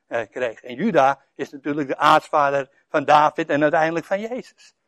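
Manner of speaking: usual speaking voice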